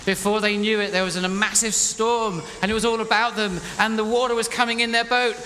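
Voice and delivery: high, loud, dramatic narration; urgent storytelling. A narrator's reading. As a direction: build the storm at full volume.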